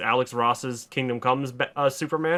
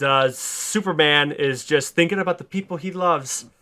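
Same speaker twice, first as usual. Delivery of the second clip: ordinary talk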